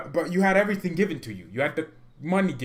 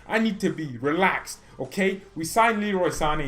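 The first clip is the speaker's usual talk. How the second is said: ordinary talk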